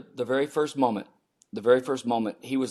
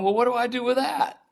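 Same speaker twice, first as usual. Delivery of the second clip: exasperated disbelief; 12 semitones higher than usual. Retold or imitated. Retold panic alone with child.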